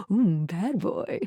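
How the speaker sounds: low, soft, slow aside; teasing coo. Acted. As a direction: coo it softly and slowly, teasing. Teasing aside about Shadow Lord.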